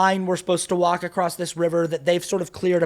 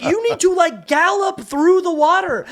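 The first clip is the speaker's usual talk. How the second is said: high, loud and slow director command; 13 semitones higher, 6 dB louder, slower than usual. Retold or imitated. Director telling actors to gallop, high, loud, drawn-out. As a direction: drag out "gallop through the water" loudly. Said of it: "he goes, like".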